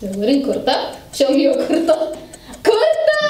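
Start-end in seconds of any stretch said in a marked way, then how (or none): 2.6-3.2 excited shout. Real, not acted.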